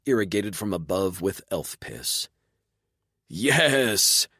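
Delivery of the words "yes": high excited yelp of joy; a voiced yip of jubilation; jubilant yip. A narrator's reading. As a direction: yip it out in triumph. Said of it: "Bask yipped in jubilation".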